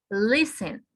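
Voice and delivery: Listen with the weight on LIS; teacher's model Listen, front syllable stressed; stress on first syllable. Shown as a demonstration. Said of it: "el acento está en".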